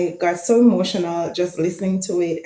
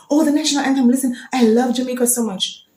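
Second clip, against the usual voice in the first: higher, louder gushing fan; mock gushing; 7 semitones higher, 4 dB louder, faster than usual. Vlogger imitates a casual listener gushing about the anthem. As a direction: gush over the anthem, lightly mocking.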